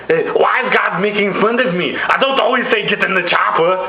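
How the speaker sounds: put-on high-pitched Austrian-accented complaint; Arnold Schwarzenegger. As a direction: protest in a mock Austrian action-hero accent. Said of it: "And Arnold Schwarzenegger's like".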